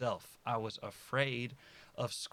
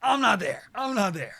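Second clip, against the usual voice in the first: higher, louder, insistent; frustrated outburst; 10 semitones higher, 11 dB louder than usual. Real, not acted. Admitting to viewers he is not there yet, frustrated outburst. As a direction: push it out in frustration.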